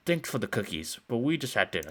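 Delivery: ordinary delivery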